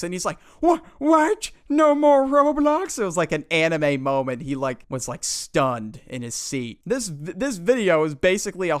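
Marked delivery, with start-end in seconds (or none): 0.6-3.0 put-on high, loud disbelieving kid; shocked kid. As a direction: cry it out in shock like a boy. Kid stunned by grounding.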